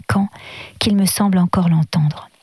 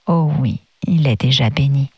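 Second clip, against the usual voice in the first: gently; 5 semitones lower than usual. A narrator's reading. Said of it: "reprit avec douceur".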